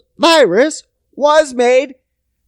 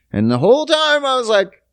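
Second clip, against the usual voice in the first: ordinary talk, nothing marked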